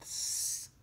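plain reading voice

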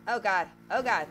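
ordinary talk

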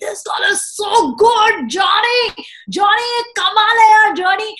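hammed-up booming compliment; excited praise. Anil Kapoor praising the daughter to her dad, mock shouting. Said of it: "Anil Kapoor ji has actually called my dad and said".

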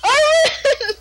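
screaming a name. Real, not acted. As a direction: shout the name at the top of your voice. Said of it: "I just started screaming".

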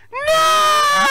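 very high, loud shriek; mock terrified refusal. Oscar refusing the fight, high shrieked refusal. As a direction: scream it out in hammed-up panic. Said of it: "Oscar said".

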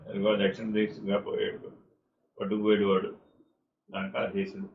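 the wounded bird's faint report to Rama; faint dying words. Acted. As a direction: give the last words with failing strength. Dying bird Jatayu tells Rama.

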